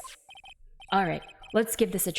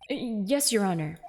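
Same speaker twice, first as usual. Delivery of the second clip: ordinary talk, nothing marked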